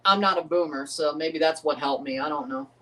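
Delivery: ordinary talk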